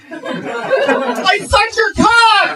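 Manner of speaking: very high, screeching mock outrage. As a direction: shriek it like a hammed-up jilted lover.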